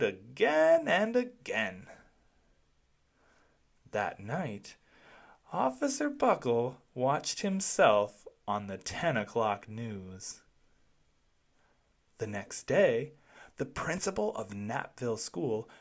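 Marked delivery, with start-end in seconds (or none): none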